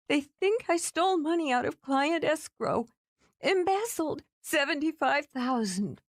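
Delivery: fired woman explaining to the man, distressed; upset young woman. A narrator's reading. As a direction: blurt it out through held-back tears.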